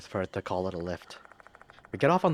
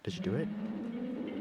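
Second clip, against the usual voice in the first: slow, breathless ghost whisper; ghostly whisper; 6 dB quieter, much slower than usual. Acted. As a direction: whisper it slowly from beyond.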